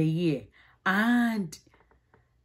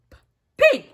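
much higher, louder "p-, pig"; playful sounding out; 13 semitones higher, 6 dB louder than usual. Shown as a demonstration. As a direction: pop the p, then shout pig. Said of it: "P is for".

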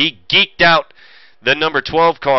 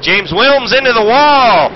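higher, louder and slowed, caught by surprise; excited crash call; 6 semitones higher, 5 dB louder, slower than usual. Real, not acted. Commentator calls a car into the wall, excited.

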